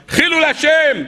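high, anguished cry, imitating Jacob; retold tearful outcry. Jacob with no gift for his bride, retold tearful outcry. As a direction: cry out in dismay, acting it for listeners. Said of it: "ופרץ בבכי".

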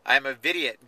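usual speaking voice

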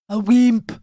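lightly put-on Austrian accent; Schwarzenegger accent. Host mocking the action hero's word, bad Schwarzenegger accent. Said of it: "thinking about my accent".

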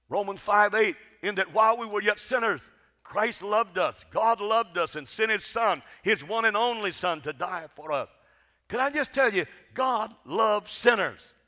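full-force preaching. Acted. Preacher to congregation, proclaiming God's love at full force. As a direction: lift the congregation with each line about God's love.

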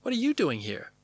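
high, swooping question; surprised greeting. Acted. Julia finding Richard on the lot, surprised. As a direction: ask it in pleased astonishment, pitch up.

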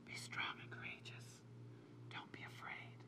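soft, intimate whisper; whispering as God. Acted. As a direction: speak God's comfort in a bare whisper. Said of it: "he whispers".

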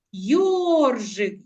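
Йоржик with a long stressed йор; slow, drawn-out first syllable of Йоржик. Shown as a demonstration. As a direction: stretch йор, let жик drop in Йоржик. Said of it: "Який склад вимовляємо з більшою силою".

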